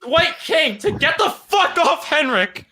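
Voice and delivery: high, loud anguished shouting; emotional outburst. Pretend. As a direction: lose it in a hammed-up yell. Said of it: "I'm gonna get emotional again".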